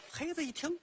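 usual speaking voice

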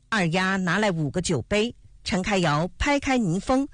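usual speaking voice

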